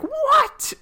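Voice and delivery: squeaky high shocked what. Retold or imitated. Retelling the whole group's stunned reaction, a high shocked squeak. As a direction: jump way up in disbelief.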